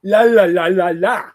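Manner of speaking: dry mouth, tongue sticking. Real, not acted. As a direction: talk with a parched mouth. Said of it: "My tongue is sticking to the roof of my mouth".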